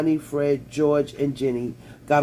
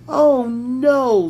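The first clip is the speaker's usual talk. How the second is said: mock dismay; 9 semitones higher, 6 dB louder than usual. A narrator's reading.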